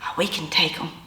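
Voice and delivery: hushed, confident whisper; a husband's secret whisper on the start line. Retold or imitated. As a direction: breathe it low, sure of winning. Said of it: "Tim whispered".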